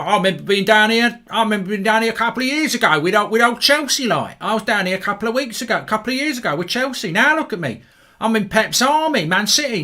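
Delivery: put-on high, loud Cockney wide boy; mock Cockney accent. Wide boy bragging about following Man City, mock Cockney. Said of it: "no way to speak about Cockneys".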